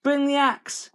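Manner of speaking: put-on high, loud boyish yell; Jack's high-pitched alarm; urgent shout. A narrator's reading. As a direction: shout for help in a hurry. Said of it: "he shouted".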